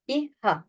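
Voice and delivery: "ihha" with no breath flowing on the h; quick Turkish-style "ihha"; clipped wrong h. Shown as a demonstration. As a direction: cut "ihha" into two short plain syllables. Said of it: "değil".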